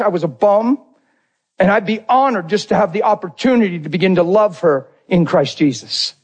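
usual speaking voice

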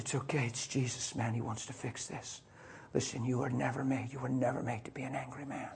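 preacher whispers comfort to the crying forklift driver; tender whisper. Retold or imitated. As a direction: soothe a weeping man close up.

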